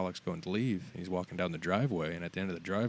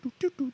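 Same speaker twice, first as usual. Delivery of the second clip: car beep imitation; 4 dB louder than usual. Imitating car remote beeps.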